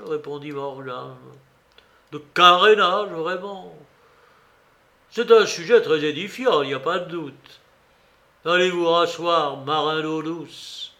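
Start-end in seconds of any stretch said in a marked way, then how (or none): 2.1-10.9 loud mock admiration, then dismissal; sarcastic scorn. A narrator's reading. Tavern cook mocking a sailor's answer, sarcastic and loud. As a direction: sneer at the answer.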